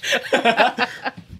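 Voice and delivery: high-pitched burst of laughter. Real, not acted. Cracking up at his own joke about the word, high laugh.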